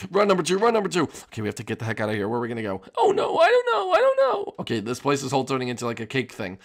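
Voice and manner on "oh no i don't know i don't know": much higher put-on panicky voice; high, squeaky, frantic panic; panicked squeak. Panicking while fleeing the teacher.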